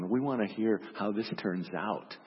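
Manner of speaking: ordinary delivery, nothing marked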